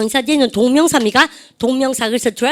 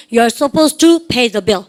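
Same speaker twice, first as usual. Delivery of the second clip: slow, a bit louder, words spaced out; slow model phrase; 5 dB louder, much slower than usual. Shown as a demonstration. Teacher modelling for class repetition, slow and a bit louder. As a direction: stretch it out for the class to copy. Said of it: "시작".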